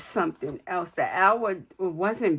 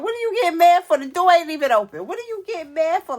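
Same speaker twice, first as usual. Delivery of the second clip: high, loud, exasperated retort; incredulous, loud scolding of people getting mad; exasperated outburst; 9 semitones higher, 8 dB louder, faster than usual. Retold or imitated. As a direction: snap back at grumbling passengers, much higher and louder. Annoyed at angry passengers.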